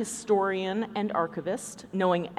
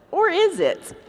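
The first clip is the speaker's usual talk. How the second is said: much higher, mock-mysterious; teasing question; 11 semitones higher than usual. Host teasing the show title, high and mock-mysterious. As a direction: tease it like a dramatic twist.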